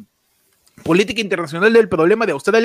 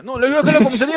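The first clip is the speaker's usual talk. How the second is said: cop shouting; 5 semitones higher, much faster than usual. Acted. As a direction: bark it like an angry cop. Policeman shouting in skit. (policía gritando)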